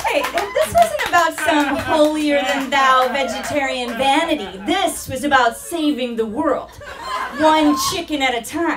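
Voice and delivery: loud self-righteous crusader tone; mock righteous proclamation. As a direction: proclaim it like a zealous activist.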